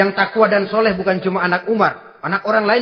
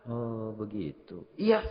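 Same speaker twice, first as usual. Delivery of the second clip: lirih dan lambat, nyaris berbisik; gumam lirih; 9 semitones lower, 13 dB quieter, slower than usual. Retold or imitated. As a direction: gumamkan pelan sambil menahan diri. Umar menahan diri. (Umar menanggapi keluhan ibu dengan gumam lirih)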